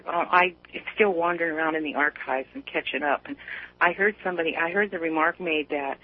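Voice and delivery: ordinary talk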